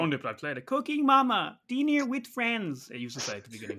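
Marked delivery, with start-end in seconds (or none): 0.6-2.9 high, slow cartoon announcer voice; sing-song game announcer, drawn out; Cooking Mama voice. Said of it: "you happy with that voice you did there?".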